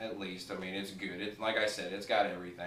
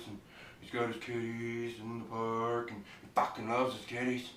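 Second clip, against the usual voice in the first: Bubbles impression; 3 dB quieter than usual. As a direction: drag it out like a sweet odd little guy. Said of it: "he talks like this".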